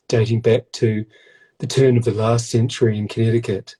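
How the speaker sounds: mock grand storyteller. Cohost joking to viewers about the dough, a hint of mock solemn narrator. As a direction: spin a fake family legend with mock gravity.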